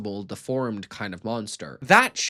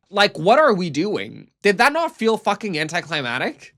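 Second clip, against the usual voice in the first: loud, high, incredulous outburst at the show; exasperated; 7 semitones higher, 8 dB louder than usual. Real, not acted. Reviewer fed up with the episode ending, loud and incredulous. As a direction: throw your hands up at the writers.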